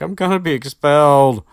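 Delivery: high, loud panicked cry; panicked wail. Retold or imitated. Ginny wakes up and panics about being expelled, high and loud. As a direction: wail it like she's doomed. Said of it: "she's like".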